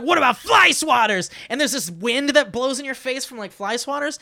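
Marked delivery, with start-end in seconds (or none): 0.0-1.3 villain shout. Retold or imitated. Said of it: "And then he's like".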